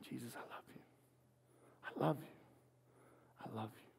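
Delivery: whispered dying declaration. Retold or imitated. Peter on the cross, whispering love to Jesus. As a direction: barely breathe the words out.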